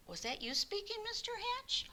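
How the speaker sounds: can't believe his ears; shocked. A narrator's reading. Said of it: "Mister Smith was shocked".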